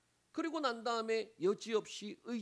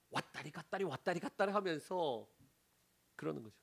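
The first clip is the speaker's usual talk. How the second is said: normal talking voice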